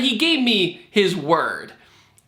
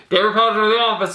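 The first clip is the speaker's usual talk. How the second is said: higher, rapid mock intercom page; intercom announcement; 4 semitones higher, 4 dB louder, much faster than usual. Intercom summons David to the office a week later, hurried announcer voice. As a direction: rattle it off like a school office announcement. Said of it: "The intercom comes on and goes".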